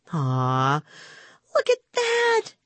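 put-on girl cooing over a rescued fly; delighted cry. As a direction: coo over him, then squeal with delight. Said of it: "cried Rima".

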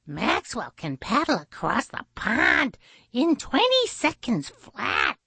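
high, chirpy bird voice; high-pitched admiring little bird; proud blue jay. Acted. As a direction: boast about a friend in a high chirp.

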